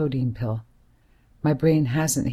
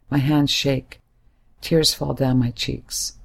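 usual speaking voice

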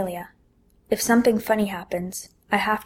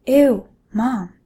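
grossed out; 3 semitones higher, 7 dB louder than usual. Acted. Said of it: "My lips curl into a frown".

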